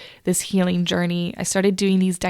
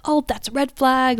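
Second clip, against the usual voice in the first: higher-pitched imitated objection; lightly mocked critic voice; imagined critic; 5 semitones higher than usual. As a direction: mimic a listener raising an alarm. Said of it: "some people might hear that".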